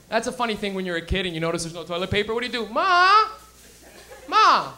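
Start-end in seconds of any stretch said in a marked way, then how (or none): 2.7-4.7 loud high yell across the house; calling out. Retold or imitated. Kid on the toilet calling his mother, loud yell. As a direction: call your mom from the bathroom.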